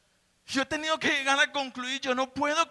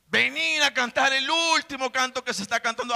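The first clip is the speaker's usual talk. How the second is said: ordinary talk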